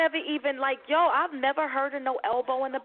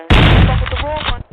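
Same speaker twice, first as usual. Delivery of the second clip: sudden loud warning on fuck; warning shout; 14 dB louder than usual. Acted. Friend warns rude women, shouting. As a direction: bark the threat out loud.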